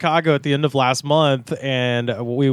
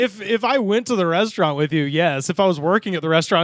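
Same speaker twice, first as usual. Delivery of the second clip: usual speaking voice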